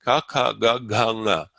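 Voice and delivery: Ka, ka, ga, ga, nga spoken as clean model syllables; velar drill. Shown as a demonstration. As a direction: sound out each velar for the class. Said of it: "They're in the back of the mouth".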